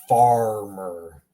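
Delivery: "farmer" with an untrilled English R; English rhotic R. Shown as a demonstration. Teacher contrasting English with Croatian, rhotic R in "farmer". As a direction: bunch the tongue for the R's in "farmer", no roll. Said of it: "That's like the rhotic R".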